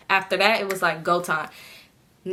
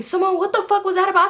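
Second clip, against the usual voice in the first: imitating angry sister; 11 semitones higher than usual. Sister demands to know what happened, mock anger. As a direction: confront her like an annoyed sister.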